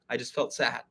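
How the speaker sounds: softly stated, no blame; matter of fact. Acted. Modelling for listeners a non-blaming admission, matter of fact. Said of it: "just matter of fact".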